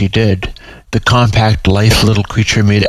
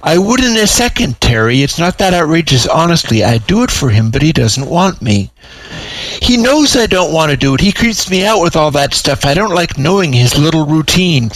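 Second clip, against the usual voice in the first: higher, squirming refusal; a lightly voiced balking cat; reluctant kitty protesting; 6 semitones higher than usual. Britteri protesting.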